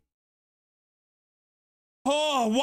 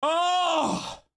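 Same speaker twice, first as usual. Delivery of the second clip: excited shout; 4 semitones higher than usual. Real, not acted. Goal moment.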